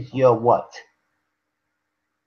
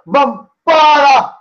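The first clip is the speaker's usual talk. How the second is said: high, slow, drawn-out Jamaican exclamation; Jamaican accent; 11 semitones higher, 8 dB louder, much slower than usual. Retold or imitated. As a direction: stretch out Bombada Jamaican-style. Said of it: "Like they say in Jamaica".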